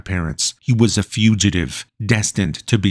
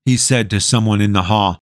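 usual speaking voice

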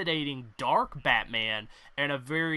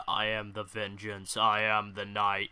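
low, measured vigilante voice; imposing Batman; 5 semitones lower than usual. Retold or imitated. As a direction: drop low into a dark, menacing declaration. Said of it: "he can easily slip the voice into one of the two roles".

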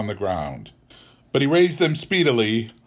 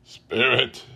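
ordinary delivery, nothing marked

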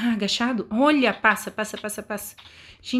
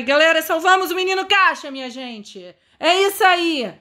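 triumphant cheer; 6 semitones higher, 3 dB louder than usual. Real, not acted. Triumphant after rescuing the box boy.

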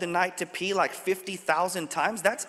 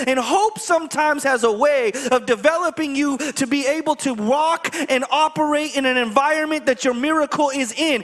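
rising, louder sermon voice, pressing each line home; full-force preaching; 5 semitones higher, 4 dB louder than usual. Acted. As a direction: preach it to the congregation. Preaching the lesson.

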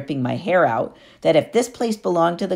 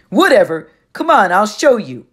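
loud, higher brush-off; dismissive bully; 6 semitones higher, 7 dB louder than usual. Acted. Clarice brushes off Percy, loud dismissive bully voice. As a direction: wave him off and take charge.

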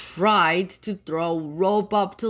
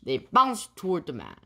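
usual speaking voice

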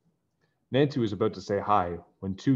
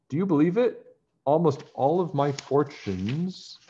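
high, louder, thrilled squeal; bragging to friends, squealing higher with glee; excited squeal; 7 semitones higher, 3 dB louder than usual. A narrator's reading. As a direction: squeal it with delight. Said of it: "Deidre squealed".

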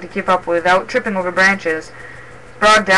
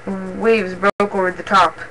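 ordinary delivery, nothing marked

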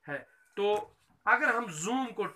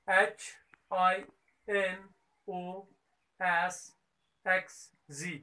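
slow spelling; much slower than usual. Shown as a demonstration. Teacher spelling letters to children.

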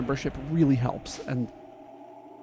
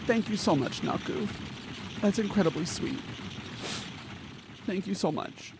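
teary thanks; 4 semitones higher, 7 dB quieter than usual. Real, not acted. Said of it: "I don't wanna cry anymore today".

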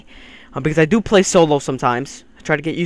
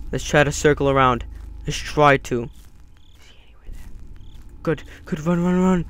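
normal talking voice

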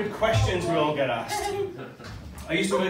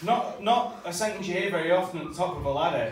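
normal talking voice

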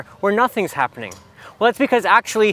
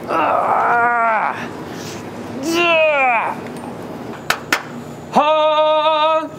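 testing shouts; 8 semitones higher, much slower than usual. Pretend. Testing shouts in dead room.

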